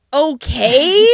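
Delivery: loud, high, questioning okay; incredulous. Retold or imitated. Driver to the man who just stares, loud incredulous okay. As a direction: throw it at someone who won't respond. Confronting the silent staring man.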